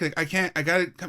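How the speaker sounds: usual speaking voice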